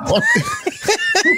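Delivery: high-pitched burst of laughter; real high laughing fit; laughing hard. Real, not acted. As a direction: crack up helplessly, high and squealing. Laughing at the hugging story.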